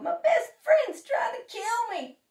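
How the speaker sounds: high, loud comic wail; crying out in alarm. A narrator's reading. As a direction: protest in hammed-up panic. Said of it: "cried Toad".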